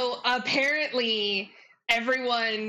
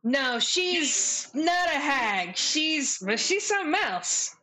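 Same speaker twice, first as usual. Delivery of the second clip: higher, drawn-out sassy character voice; game character; 6 semitones higher, slower than usual. Acted. Character answers whether she's a hag, high and drawn out. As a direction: stretch it out high, with knowing emphasis. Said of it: "she goes".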